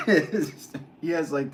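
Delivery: high, loud, laughing through the words; amused laughter. Real, not acted. Streamer recalling the ridiculous slug race, amused laughter. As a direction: crack up while trying to explain.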